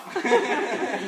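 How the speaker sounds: a loud, high burst of laughter. Real, not acted. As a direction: laugh out hard. Laughter after anecdote.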